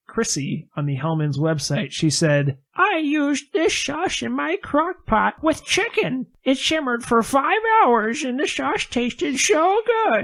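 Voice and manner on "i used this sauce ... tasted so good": high, slow, hammed-up old lady. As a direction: read it like a sweet granny. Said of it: "that's my old lady voice".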